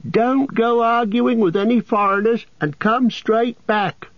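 high call after her husband. A narrator's reading. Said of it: "she called".

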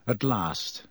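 usual speaking voice

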